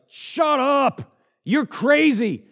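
high, loud scornful shout; mocking Festus. Festus yelling at Paul, high and scornful. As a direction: snap at him like he's lost his mind. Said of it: "Festus is like".

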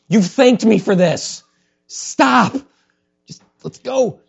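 high, loud mock scolding; exasperated God. God telling complainers to stop, loud mock exasperation.

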